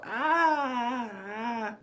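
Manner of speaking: high-pitched mock angry scream; angry yell. Kid with no fighting skills at boxing, mock angry yell. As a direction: imitate a kid's drawn-out, high angry yell. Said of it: "doing his angry yell".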